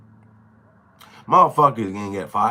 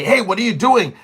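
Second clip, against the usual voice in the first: sharp, raised alarmed question; surprised call; 7 semitones higher, 5 dB louder than usual. Retold or imitated. Ambulance guy stops the nurse, alarmed raised voice. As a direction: call out to stop her. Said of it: "proceed to say".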